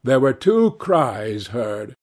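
plain reading voice

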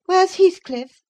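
high-voiced young mistress asking sharply; Catherine. A narrator's reading. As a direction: pitch it high and loud, an abrupt question. Narrator voices Catherine's dialogue.

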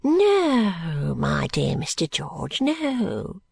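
oily, sing-song reassurance; placating old man. Acted. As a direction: soothe him with drawn-out old-man assurances. Old man soothes the trooper.

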